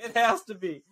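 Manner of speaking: high-pitched, talking through laughter; laughing. Real, not acted. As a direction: laugh helplessly as you agree. Amused reply about the meme play.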